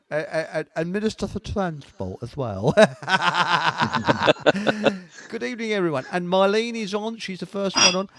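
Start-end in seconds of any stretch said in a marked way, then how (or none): 2.8-5.2 big loud laughter. Real, not acted. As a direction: burst out laughing. Laughing at title.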